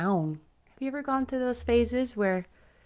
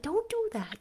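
high mock warning; playful scolding; 13 semitones higher, 4 dB quieter than usual. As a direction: scold them, much higher. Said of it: "skipping ahead".

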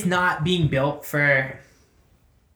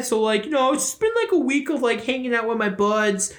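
higher put-on voice with an odd accent; Larry; 3 semitones higher than usual. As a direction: play the chatty pet in a silly accent. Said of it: "I don't know why Larry has that accent".

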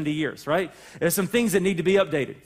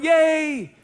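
high, loud mock cheer of delight; 7 semitones higher, 5 dB louder than usual. Preacher mimicking the crowd, a delighted cheer. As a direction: cheer it like the whole room is thrilled. Congregation cheering at good news.

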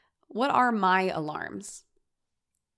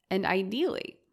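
usual speaking voice

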